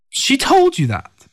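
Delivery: high, slow disbelieving question; surprised. Acted. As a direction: ask it in stunned disbelief. Stunned reply to revealed news.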